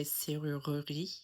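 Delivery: serrurerie in a Southern French accent, middle E sounded; Southern French, keep every syllable of serrurerie. Shown as a demonstration. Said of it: "with a Southern French accent".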